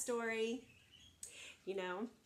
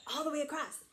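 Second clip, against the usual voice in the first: usual speaking voice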